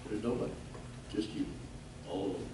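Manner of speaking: very soft and low, broken by pauses; low, lonely murmur; hushed loneliness. Real, not acted. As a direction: drop almost to nothing, phrase by phrase. Voicing the alone feeling for listeners.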